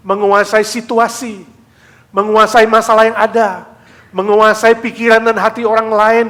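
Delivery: loud, high, fervent sermon delivery; rising pulpit preaching; preaching at full force. Acted. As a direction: proclaim it to the congregation. Preaching the lesson.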